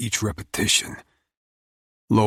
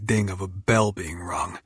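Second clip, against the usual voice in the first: usual speaking voice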